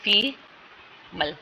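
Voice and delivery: फीमल stretched very slowly, each syllable held; teacher stretching फीमल for the class; drawn out demo. Shown as a demonstration. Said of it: "F I एम L spelling है".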